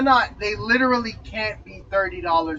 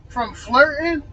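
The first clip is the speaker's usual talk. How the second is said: incredulous outburst; 7 semitones higher, 8 dB louder, slower than usual. Real, not acted. Outraged at sister's claim.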